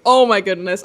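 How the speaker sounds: ordinary delivery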